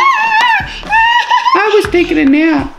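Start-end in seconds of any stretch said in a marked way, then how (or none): 0.0-1.7 high, slow laughing shout; high doll shout. Pretend. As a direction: squeal it while laughing. Doll woken from nap.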